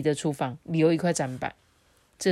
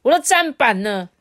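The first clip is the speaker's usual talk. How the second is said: loud, much higher furious shout; angry roar; 8 semitones higher, 9 dB louder than usual. Acted. Older brother discovers his chopping board gone, roaring in fury. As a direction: bellow it like a shopkeeper robbed blind. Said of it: "传来一声怒吼".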